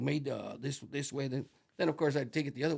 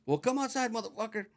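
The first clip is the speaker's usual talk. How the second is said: yelling at the owner through the door; retold shout; 8 semitones higher than usual. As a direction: dare him to step outside.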